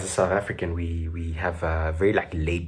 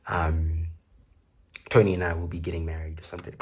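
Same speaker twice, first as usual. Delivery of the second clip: ordinary delivery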